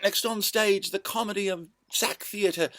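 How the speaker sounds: high, bright barker announcement; stage announcer. Retold or imitated. Promoting the next act to fairgoers, high barker voice. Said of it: "And I promoted them".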